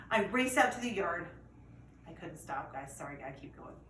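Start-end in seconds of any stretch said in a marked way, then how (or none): none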